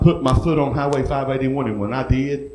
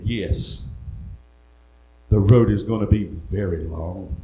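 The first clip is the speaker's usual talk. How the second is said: low, measured divine reply; the Lord; 6 semitones lower, 4 dB quieter than usual. Retold or imitated. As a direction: answer gravely in a lower voice. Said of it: "he said".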